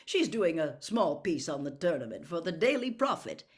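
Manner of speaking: hearty Bagman. Acted. Jolly judge welcoming the young champion, loud and booming. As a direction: welcome him with big bluster.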